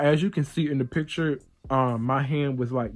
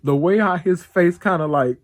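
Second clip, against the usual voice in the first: laughing through the words; cracking up as she remembers his face; 4 semitones higher, 5 dB louder, slower than usual. Real, not acted. Recalling her brother's scared face, laughing.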